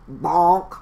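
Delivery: loud comic bonk; bonk sound. Acted. Baton hits Froggy on the head, loud bonk. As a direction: punch the sound of a hit.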